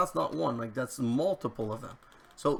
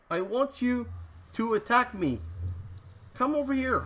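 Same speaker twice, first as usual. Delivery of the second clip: frustrated calling; 10 semitones higher than usual. Real, not acted. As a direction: beg the mobs to attack, fed up. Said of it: "now I'm just frustrated".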